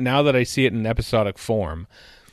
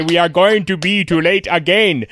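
higher, louder hammy line delivery; Jack May line reading; 7 semitones higher, 7 dB louder than usual. Retold or imitated. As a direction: ham up the line like the actor. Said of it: "if he said that normally".

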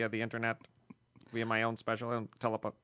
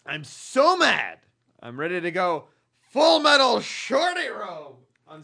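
mock angry; 7 semitones higher, 10 dB louder than usual. Reading listener email in voice.

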